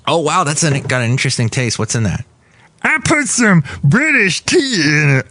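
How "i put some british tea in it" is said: very high, squeaky cartoon brewer voice; high cartoon brewer offering his root beer; Brewmaster character.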